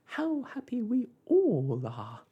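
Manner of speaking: high, loud, slow joyful lady's voice; Miss Tartlet crying out. A narrator's reading. Miss Tartlet to everyone in the room, high and joyful. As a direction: cry it out in high delight. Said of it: "cried Miss Tartlet".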